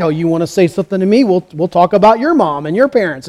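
ordinary delivery

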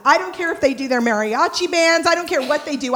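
ordinary talk, nothing marked

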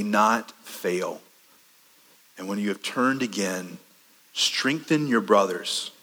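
slow, low, tender solemnity; soft and measured, pausing between phrases; solemn reassurance. Acted. Jesus tells Peter of his prayer, solemnly tender.

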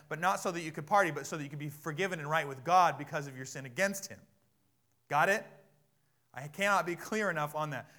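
loud, emphatic pulpit warning; forceful, loud exhortation; insistent preaching. Real, not acted. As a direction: drive the point home to the congregation.

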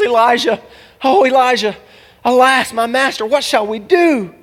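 high, loud cry of alarm; hammed-up wail to the master for help; panicked servant. Acts servant running back panicked.

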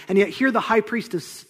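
usual speaking voice

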